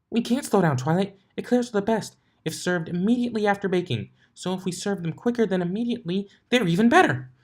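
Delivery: high, excited, bubbly pony voice; Pinkie's voice. A narrator's reading. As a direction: rattle it off high and fast with glee. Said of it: "Pinkie turned their dial".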